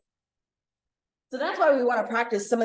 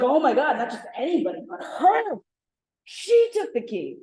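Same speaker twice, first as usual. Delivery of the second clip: shocked gossip, stretched and pitched up; scandalized accusation; 4 semitones higher than usual. Acted. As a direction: accuse her, punching who it was.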